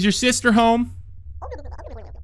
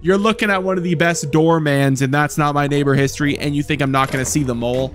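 usual speaking voice

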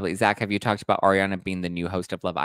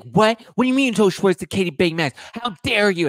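high, loud, rapid-fire indignation; mock outrage; 11 semitones higher, 7 dB louder than usual. Retelling her confronting him over the Katie secret, mock outrage. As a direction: act out an appalled, furious confrontation. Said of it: "Why are you getting so mad at me".

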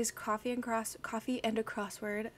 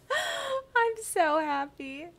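laughing, slow, higher and louder by the end; overjoyed laughing; 5 semitones higher, 4 dB louder, much slower than usual. Real, not acted. Streamer overwhelmed with joy by chat, laughing through it. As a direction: gush with tearful joy. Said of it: "I could cry".

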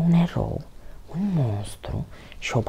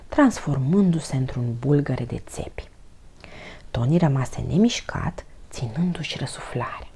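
usual speaking voice

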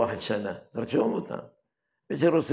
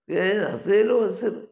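retold cry for help; 9 semitones higher, 4 dB louder than usual.

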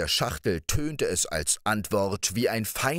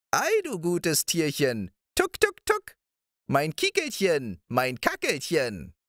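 put-on old moor witch; 9 semitones higher than usual. A narrator's reading. Old witch cooing over Balthasar's hen, high crooning voice.

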